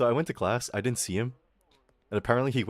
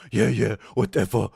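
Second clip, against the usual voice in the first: put-on taunting voice, a little louder; mocking classmate; 4 dB louder than usual. Schoolboy taunting the gold house captain, mocking put-on voice. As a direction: imitate a dismissive schoolboy jeer.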